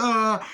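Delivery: short high cry of pain. Retold or imitated. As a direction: yelp as if hit. She is shot in the leg.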